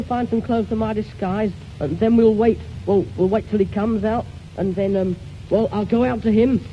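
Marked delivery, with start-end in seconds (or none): none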